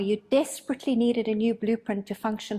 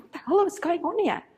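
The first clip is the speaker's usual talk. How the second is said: higher-pitched mock alarm; retold panic; 6 semitones higher than usual. Healer, panicking inside during the session: mock alarm. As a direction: act out the inner panic, a touch hammed-up. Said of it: "I was panicking".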